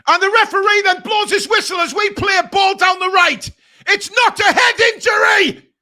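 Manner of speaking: outraged roar, slowed on the last line; furious shout. Real, not acted. Fan raging at the referee's whistle, furious shout.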